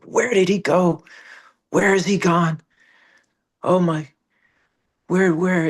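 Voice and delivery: put-on anxious calling for the vanished boy; frantic searching. Saint searching the doorway for the boy, frantic and high.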